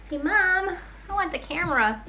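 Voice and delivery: high pretend child voice; voicing the baby. Mother voices her baby's wish.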